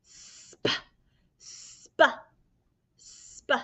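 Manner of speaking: voiceless sp; whispered sp blend. Shown as a demonstration. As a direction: breathe out sp without voice. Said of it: "This is".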